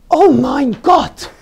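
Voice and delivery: put-on shrill-free high, loud, slow German old lady gasp; German accent. Old German lady with a walker startled by a costume, loud, high German accent. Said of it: "she had a German accent".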